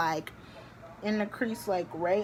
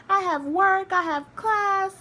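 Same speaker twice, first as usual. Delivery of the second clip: much higher, louder, strained whine; desperate protest; 11 semitones higher, 6 dB louder than usual. Retold or imitated. Daughter protesting to mom she has work, high loud whine. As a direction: wail it like a stressed-out kid. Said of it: "I'm like".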